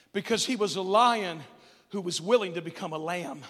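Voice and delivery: ordinary talk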